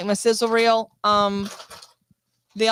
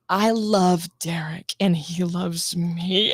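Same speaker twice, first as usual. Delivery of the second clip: slow, lower put-on ad read; slow commercial voice; 4 semitones lower, slower than usual. Shown as a demonstration. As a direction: read the line slow and solemn like an ad. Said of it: "I go really low".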